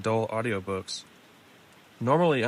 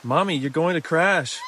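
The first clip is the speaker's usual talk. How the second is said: put-on higher, louder cry of a frightened child; panicked child's warning, pitched up; screaming kids; 7 semitones higher, 5 dB louder than usual. A narrator's reading. Kids to mom in the speeding car, screaming in alarm. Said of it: "The kids are screaming".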